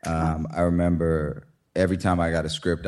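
usual speaking voice

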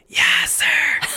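unvoiced, breathy ghost whisper. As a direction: rasp it like a ghost. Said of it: "What if you heard".

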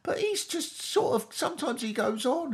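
put-on high, far-off voice of an older bystander, brushing it off; dismissive older person. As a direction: shrug it off like a dotty older person. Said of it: "you'd go".

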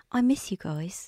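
slow, drawn-out attempt at an Australian accent; imitated Australian accent. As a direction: stretch the vowels into a rough Australian accent. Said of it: "that's not Australian".

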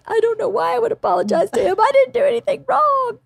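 high-pitched, hurried protest; retold aggrieved whine; whining kid. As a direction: imitate a daughter wailing that she's innocent. Daughter protests having to apologize.

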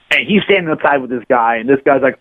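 ordinary talk, nothing marked

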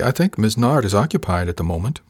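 ordinary delivery

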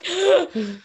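heavy gasp, fighting nausea; acted gasping for breath. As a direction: re-enact sucking in air while winded. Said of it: "I'm focusing on my breathing".

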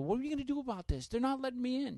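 high, rushed, agitated complaint; freaking out. Retold or imitated. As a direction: retell a frantic call to wife. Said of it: "then I'm freaking out".

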